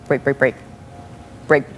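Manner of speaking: low, muttered warning, imitated; under his breath. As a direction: mutter it rapidly from the back seat. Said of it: "under his breath".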